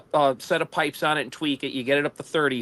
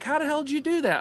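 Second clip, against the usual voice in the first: higher, incredulous question; amazed; 11 semitones higher, faster than usual. Retold or imitated. Buddy asking the rider at the road's end, incredulous. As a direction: disbelief at being beaten.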